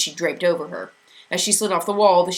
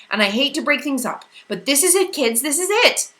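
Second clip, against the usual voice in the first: higher, louder eager voice; barely contain the thrill; quivering excitement; 9 semitones higher, 6 dB louder than usual. A narrator's reading. Charles Wallace announcing they are leaving, excited. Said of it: "His voice quivered with excitement".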